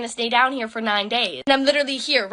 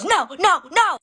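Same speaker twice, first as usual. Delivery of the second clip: higher, louder mock lady's yelling; 6 semitones higher, 4 dB louder, slower than usual. Lady answering the security guard, mock shouting. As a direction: throw her stubborn no back at security. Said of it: "looks at the security guard and goes".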